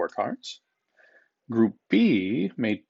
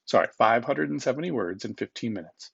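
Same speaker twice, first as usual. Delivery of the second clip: usual speaking voice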